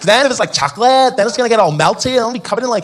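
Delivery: put-on high, rising whiny girl. Beach girl imagining the mess, high whiny complaint. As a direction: whine about getting sticky.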